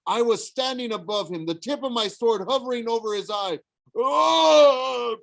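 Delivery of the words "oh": pained moan. A narrator's reading. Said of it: "Polyphemus moaned".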